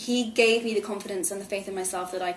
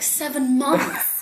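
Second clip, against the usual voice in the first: ordinary talk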